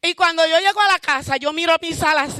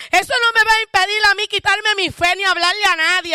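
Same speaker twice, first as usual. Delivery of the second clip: rising, louder preaching cry; fervent preaching; 3 semitones higher, 4 dB louder than usual. Real, not acted. Preacher declaring her faith to the congregation, full force preaching. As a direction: lift the church with a swelling, loud proclamation.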